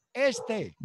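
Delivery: high, curt "Este" picking out a recruit; imitated sergeant picking. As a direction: point at him and say "Este" sharply, with a high voice. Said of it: "el sargento decía".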